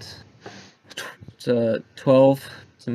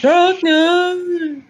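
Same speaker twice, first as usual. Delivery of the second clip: huge booming high call; fighting game announcer; 6 dB louder than usual. Pretend. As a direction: bellow the name like an arena announcer.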